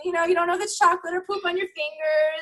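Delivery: gushing through giggles about mom chaos; excited laughing rant. Real, not acted. Mom laughing with the host about postpartum chaos, excited and high.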